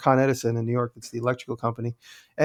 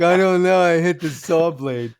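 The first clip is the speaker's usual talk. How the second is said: mock embarrassed shrug; 3 semitones higher, 5 dB louder, slower than usual. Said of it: "I'm like".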